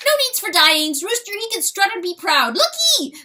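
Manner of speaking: very high, loud, cheering; excited cry. Acted. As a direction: cheer him on eagerly. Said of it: "cried John Wesley".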